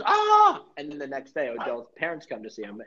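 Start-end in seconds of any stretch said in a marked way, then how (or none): 0.0-0.6 very high, loud mock scream; short hammed-up shriek; mock pained yelp. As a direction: yelp as if bitten. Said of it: "And Odell goes".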